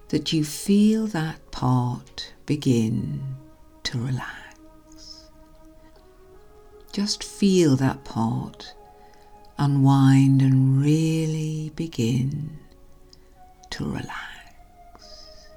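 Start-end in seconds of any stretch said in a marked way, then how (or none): 3.8-5.3 slow breathy whisper, much softer; whispered suggestion. Acted. Hypnosis suggestion trailing into whisper. 13.7-15.5 slow breathy whisper, much softer; whispered suggestion. Acted. Hypnosis suggestion trailing into whisper.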